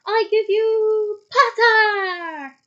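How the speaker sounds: loud, high stadium announcer, drawn out slowly; Ludo Bagman. A narrator's reading. Bagman announces Potter to the stadium, booming announcer's call. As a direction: boom it out to a roaring crowd, stretching every word. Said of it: "voice echoed throughout the stadium".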